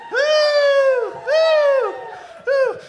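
very high, loud whooping; excited whoops. Real, not acted. Comic hyping up the crowd, high loud whoops. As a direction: whoop like a cheering fan.

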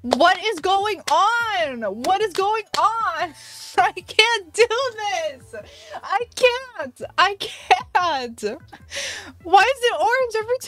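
a real high-pitched rant of disbelief; exasperated outcry. Real, not acted. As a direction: wail at the unfairness of it. Orange villager again, outraged.

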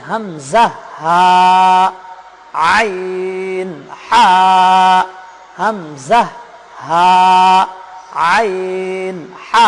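হামজা, হা, আইন, হা, each deliberate and held from the throat; articulation drill. Shown as a demonstration. Teacher repeating letters for viewers to match, held throat articulation. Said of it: "উচ্চারণগুলো আরেকবার মিলে নিই".

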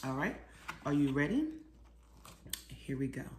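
plain reading voice

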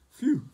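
loud, high puff of relief; big storybook "phew"; relieved phew. A narrator's reading. Honk after digging his hole, a loud relieved phew.

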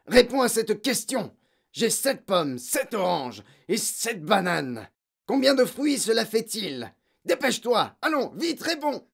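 high-pitched, insistent quizzing; bullying interrogation; domineering headmistress. A narrator's reading. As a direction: drill the pupil, then hurry him.

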